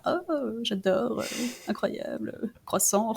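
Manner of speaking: soft, lightly mocked swooning; hint at fans cooing over French words; gushing fans imitation. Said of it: "les gens sont là".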